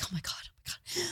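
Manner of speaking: whispered oh my God in panic; whispering panic; panicked whisper. Retold or imitated. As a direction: breathe it out, overwhelmed. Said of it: "She's like".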